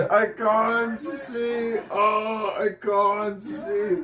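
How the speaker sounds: high, slow mock wail; hammed-up crying imitation; mimicking crying. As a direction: whine it out like he's blinded. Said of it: "I'm trying to mimic him".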